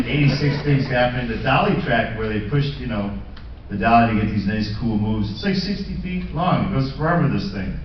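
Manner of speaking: usual speaking voice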